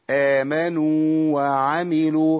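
آمنوا with the و stretched, then a separate و in وعملوا; slow tajweed recitation; correct madd. Shown as a demonstration. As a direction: keep both واو distinct, madd full. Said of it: "يبقى إيه؟".

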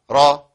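«را» loud, with a full, heavy r. Shown as a demonstration. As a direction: sound a dark, emphatic «را». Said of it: "الراء مفخمة". («را» براء مفخمة)

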